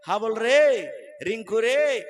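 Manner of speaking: drawn-out call, rising then dropping; mock summons with stretched endings; calling out to sons. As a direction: call each child's name out loud. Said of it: "একবারে ডাকবো".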